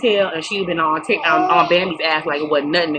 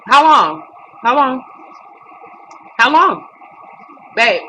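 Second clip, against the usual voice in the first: impatient calling out; 7 semitones higher, 6 dB louder, slower than usual. Real, not acted. Talking to someone off camera.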